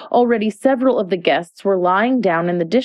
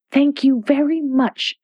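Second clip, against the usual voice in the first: ordinary delivery, nothing marked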